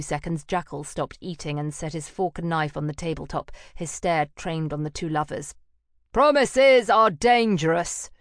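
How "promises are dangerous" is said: slow, loud, heavy warning; menacing warning. Acted. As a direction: lean on every word as a threat. Jackal threat.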